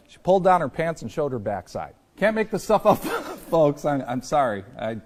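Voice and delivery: anchor stifling giggles. Real, not acted. Anchor cracking up on air.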